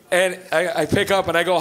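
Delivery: ordinary talk, nothing marked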